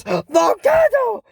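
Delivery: high, slow shouted Volcano!; girl blasting her mentor with a spell, shouting. Acted. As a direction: yell Volcano! like casting a spell. Casting a spell to zap him.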